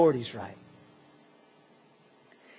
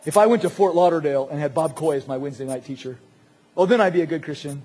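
lightly voiced whiner with a lower pitch; mock excuse-maker; 4 semitones lower, faster than usual. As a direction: make a lame excuse in a put-on voice. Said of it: "you can give the excuse".

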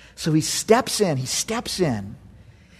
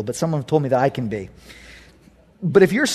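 ordinary delivery

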